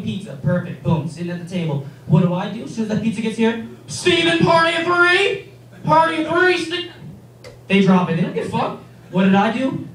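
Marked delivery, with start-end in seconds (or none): 3.9-6.9 high, drawn-out announcement across a restaurant; calling out. Acted. Pizza worker calls Steven's party, loud singsong hail. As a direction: call the party name loudly, stretching the last word.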